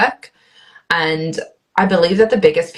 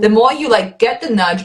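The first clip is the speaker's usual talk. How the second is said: ordinary talk, nothing marked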